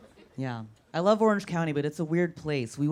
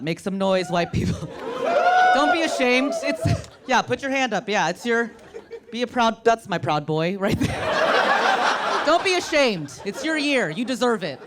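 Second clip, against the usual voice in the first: higher, louder emcee hype; crowd hype; 7 semitones higher, 5 dB louder than usual. Real, not acted. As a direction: pump up the crowd.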